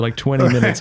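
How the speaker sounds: ordinary talk, nothing marked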